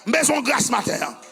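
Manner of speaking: loud, high preacher's cry; preaching at full force. Acted. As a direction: proclaim it to the whole church. Preaching to the congregation.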